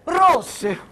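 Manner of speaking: high excited cry; triumphant exclaim. Acted. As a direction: announce it like a triumph.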